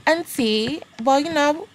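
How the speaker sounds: high sweet voice. Retold or imitated. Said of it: "So I was like".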